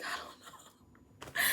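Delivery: breathless, slow, voiceless whisper; panicked whisper. Retold or imitated. Student whispers to the principals, panicking. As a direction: whisper it, lost and scared. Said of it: "panicking, crying".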